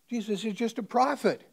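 loud, high, indignant shouting; student shouts angrily at his teacher; outraged protest. Retold or imitated. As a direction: cry out in shocked outrage. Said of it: "he gets excited".

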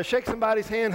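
normal talking voice

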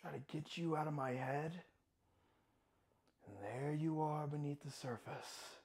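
very soft, low, inward; quietly haunted; haunted hush. Acted. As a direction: sink to a low murmur after the outburst. Monologue, haunted after outburst.

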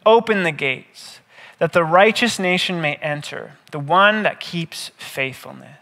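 usual speaking voice

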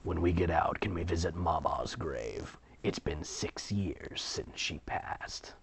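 quiet, low plea; soft sad whisper. Acted. Twin quietly asking to visit mama's grave. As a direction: ask gently about mama's grave.

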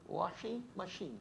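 "Washing machine." said slow and soft in a Brazilian Portuguese accent, "ashi machin"; quiet Brazilian Portuguese "Washing machine." with a falling tone. Shown as a demonstration. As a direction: drop the w in "Washing machine." and settle it slowly in Brazilian Portuguese style. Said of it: "Tá certo meu accent?".

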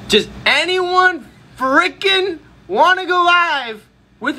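high, drawn-out exasperated plea; raging rant. Real, not acted. Streamer begging viewers to go live, raging. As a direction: whine it out slowly with big frustrated stress. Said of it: "I should stop raging".

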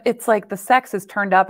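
ordinary talk

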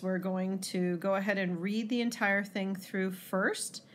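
ordinary talk, nothing marked

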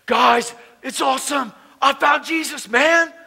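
high, loud, eager retold news; re-enacted burst of enthusiasm; excited announcement. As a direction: proclaim it to the whole band. Announcing his news to the band.

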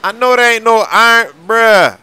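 loud, high disbelieving shout; can't believe your eyes, shouting; shocked. Acted. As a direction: yell it in total disbelief. Shocked at Iron Man suit.

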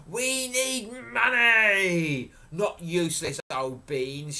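loud, high, drawn-out outburst, easing off; furious cry. A narrator's reading. Furious mother scolding Jack over useless beans, crying out. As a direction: wail it like an exasperated mother. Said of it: "she cried".

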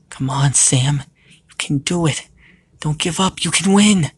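high, building pep talk; urgent encouragement. Acted. Lilith's thought urges Sam to fight back, encouraging.

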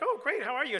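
squeaky hammed-up pastor hello; forced cheer. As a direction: put on a surprised, eager welcome. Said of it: "I'm like".

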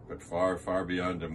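usual speaking voice

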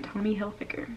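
softly, "Hilfiger" as "Hil-fig-er"; correct Hilfiger. Shown as a demonstration. Vlogger thanking viewers for the correction, soft "Hil-figger". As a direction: say "Hilfiger" quietly with a hard g. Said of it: "the right way to say it".

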